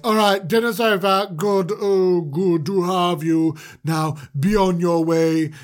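brisk put-on host dismissal; affected character voice, dismissing the guests; Mister Manager. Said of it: "his voice sounds a little different".

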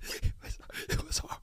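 whispered, embarrassed admission; sheepish whisper. Real, not acted. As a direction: barely get the words out. Embarrassed admission while laughing.

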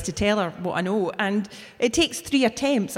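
normal talking voice

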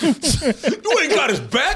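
slow, laughing through the line; laughing quote. Retold or imitated. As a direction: giggle out the movie quote. Quoting the movie line laughing.

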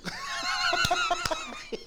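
loud high burst of laughter. Real, not acted. Laughing hard at his own punchline, loud. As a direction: crack up loudly.

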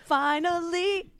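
high, drawn-out sigh of relief; slow, high teasing finally; mock rapture. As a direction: stretch it like a prayer answered. Teasing mock swoon about Mike.

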